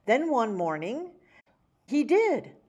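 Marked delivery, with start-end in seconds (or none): none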